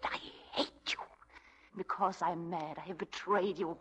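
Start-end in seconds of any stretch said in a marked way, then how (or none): 0.0-1.0 a low, venomous whisper; hissed whisper. Acted. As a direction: whisper it with cold hatred. Unvoiced whispered hate.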